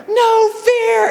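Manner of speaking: very high, drawn-out holler; imitated battle yell. Crew chief yelling his helmet motto at the last man, imitated yell. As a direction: mimic a crew chief hollering a war cry. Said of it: "Norton's yelling at me".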